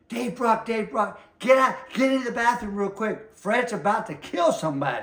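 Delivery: high, pressing doorman's warning; urgent warning. Retold or imitated. Doorman urgently warning Dave away from a fight. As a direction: hurry him into the bathroom.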